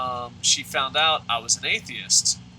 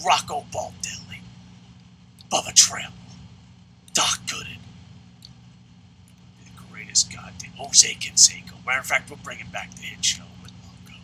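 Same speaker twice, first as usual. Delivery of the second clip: forceful, voiceless stage whisper; whispered roll call. Pretend. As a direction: rasp out each name in a heavy whisper. Listing players for his ultimate roster.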